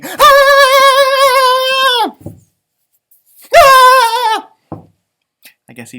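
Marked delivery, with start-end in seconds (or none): 0.0-4.5 long high-pitched scream; loud, drawn-out shriek. A narrator's reading. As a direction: scream while fleeing in terror. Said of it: "ran off screaming".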